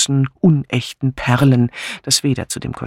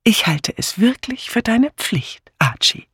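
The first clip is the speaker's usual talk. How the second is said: hohe Stimme, leicht gehaucht, feierlich; erkläre es mit sanftem Ernst; 10 semitones higher than usual. A narrator's reading. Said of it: "erklärte sie feierlich". (feierlich mahnende Ehefrau)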